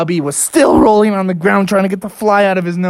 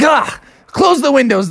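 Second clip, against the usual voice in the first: higher-pitched urgent cry; mock alarm; 7 semitones higher than usual. Family sees flies buzzing around, alarmed. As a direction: sound the alarm about the flies.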